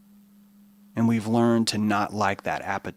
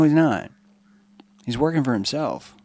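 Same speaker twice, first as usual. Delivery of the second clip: normal talking voice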